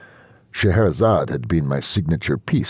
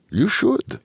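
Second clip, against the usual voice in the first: put-on high, rising "You should" with faint Ukrainian accent. A narrator's reading. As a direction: lift "should" high at the end, lightly Ukrainian. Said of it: "in her faint Ukrainian accent".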